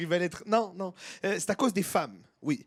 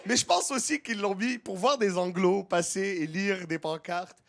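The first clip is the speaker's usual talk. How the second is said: ordinary delivery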